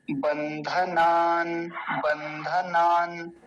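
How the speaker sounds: बंधनान slowly, the न stopped without a vowel; careful recitation. Shown as a demonstration. Teacher demonstrating the halant, very slow. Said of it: "नकार हलंत देखिए बीच में है".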